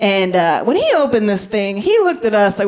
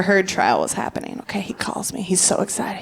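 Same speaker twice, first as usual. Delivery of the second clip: whispered, conspiratorial; hushed aside; 3 semitones lower, 7 dB quieter than usual. Acted. Comic telling the crowd a secret, in a whisper. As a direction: lean in and whisper the setup.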